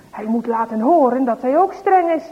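plain reading voice

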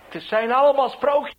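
loud, high jeering shout; heckler's yell. Retold or imitated. Market heckler jeers at the preacher, shouting loudly. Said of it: "Te roepen en te tieren".

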